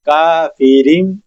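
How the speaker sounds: کافرین slowly, ending on m, kafirim; iqlab demo. Shown as a demonstration. Teacher reading the Quranic example slowly. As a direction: drag کافرین out with a closing m. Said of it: "یہاں پر ہم چھوٹی سی میم ادا کریں گے".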